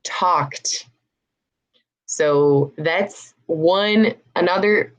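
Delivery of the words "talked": "talked" modelled as tawkt; one syllable "talked", released T at the end; final T sound. Shown as a demonstration. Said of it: "Try to say it with me".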